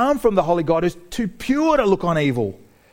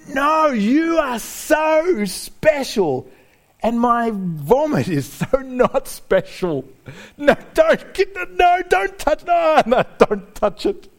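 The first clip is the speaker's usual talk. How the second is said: high, slow, pleading "no, don't touch"; horrified protest; 8 semitones higher, 3 dB louder, much slower than usual. Retold or imitated. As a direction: squeal in embarrassed panic at the hero cleaning up. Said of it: "You'd just think".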